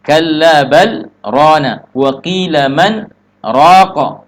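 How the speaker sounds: slow recitation halting before "rana" and before "raqa"; drawn-out "Kalla bal rana wa qila man raqa" with short silent cuts; sakta pauses. Shown as a demonstration. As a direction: stop breathless after "bal" and after "man".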